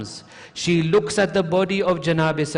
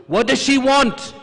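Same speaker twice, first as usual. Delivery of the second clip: high, sudden raised question; irritated demand; 8 semitones higher, 4 dB louder than usual. Retold or imitated. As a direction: demand it impatiently.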